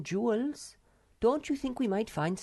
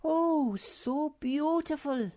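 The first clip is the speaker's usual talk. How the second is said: very high, slow gush of delight; drawn-out, high-pitched admiration; rapt exclamation; slower than usual. A narrator's reading. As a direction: sigh it out high, enchanted. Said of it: "exclaimed the Snork Maiden".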